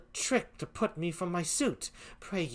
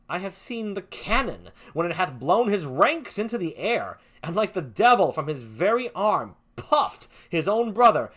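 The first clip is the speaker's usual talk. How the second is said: building intensity; 5 semitones higher, 9 dB louder than usual. Acted. Iago builds a vivid image.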